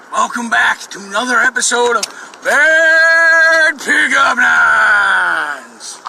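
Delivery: loud, drawn-out host intro; hammed-up game show announcer. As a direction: stretch the title like a TV host. Announcing show segment title.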